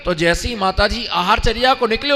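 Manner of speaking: ordinary talk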